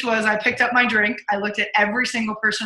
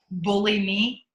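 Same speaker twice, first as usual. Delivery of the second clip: ordinary talk, nothing marked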